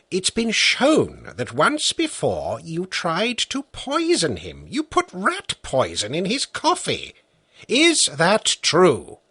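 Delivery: patient judge. A narrator's reading. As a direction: lead the witness step by step.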